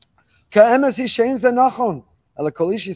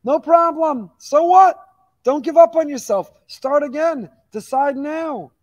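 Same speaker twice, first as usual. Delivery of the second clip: much higher and louder, fiery and urgent; rousing cry; 7 semitones higher, 5 dB louder, slower than usual. Real, not acted. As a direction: lift the whole room to its feet. Preacher rallies congregation not to despair.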